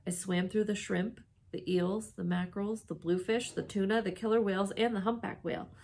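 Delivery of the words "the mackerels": ordinary delivery, nothing marked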